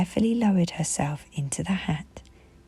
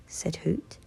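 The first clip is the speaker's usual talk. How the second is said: plain reading voice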